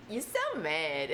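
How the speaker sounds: slow, teasing coo through a laugh; cooing at an angry singer, slow and laughing; laughing mock baby talk. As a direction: tease a grumpy baby fondly. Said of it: "sometimes it's like".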